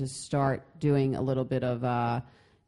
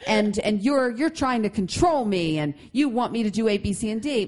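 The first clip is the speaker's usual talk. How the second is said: whiny, louder, higher-pitched complaint; complaining husband; 6 semitones higher, 5 dB louder than usual. Retold or imitated. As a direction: mimic a husband griping to his wife. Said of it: "they'll say".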